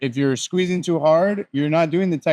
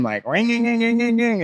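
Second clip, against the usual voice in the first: rising engine rev noise; revving engine; 9 semitones higher than usual. Shown as a demonstration. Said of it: "you can hear the R P M".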